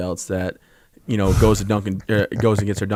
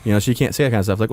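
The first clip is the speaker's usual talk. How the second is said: ordinary delivery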